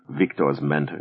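usual speaking voice

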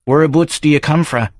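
gruff Edinburgh local sizing up a stranger; Davy's Scots brogue; Scottish barfly. Acted. Davy asks stranger.

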